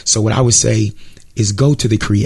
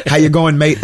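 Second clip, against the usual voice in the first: raised-pitch mock Australian greeting; Aussie accent; 5 semitones higher, 3 dB louder than usual. Guest replays his pretend call to the host, Aussie accent. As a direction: try out a cheery Aussie accent. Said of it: "That's my, that's my Aussie".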